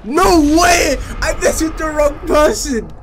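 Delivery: loud, high panicked shouts; excited yelling. Real, not acted. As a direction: yell in frantic alarm. Enemy chases him, panic.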